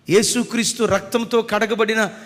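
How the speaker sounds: high, loud preaching cry; fervent preaching. Acted. Preacher proclaiming to the congregation in a loud fervent cry.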